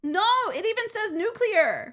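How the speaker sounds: high-pitched arguing back; indignant, high and insistent; indignant protest. Real, not acted. Argues back about pronunciation.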